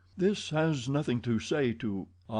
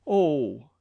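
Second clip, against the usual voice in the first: sharp loud cry of alarm; cried out; 4 semitones higher, 6 dB louder than usual. A narrator's reading. Young man crying out on hearing the news. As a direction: burst out with it. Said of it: "cried the young man".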